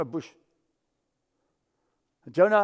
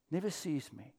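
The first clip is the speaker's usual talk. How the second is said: soft, much quieter echo; quiet repeat; 3 semitones lower, 12 dB quieter than usual. Retold or imitated. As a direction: let it sink in quietly. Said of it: "And they would say".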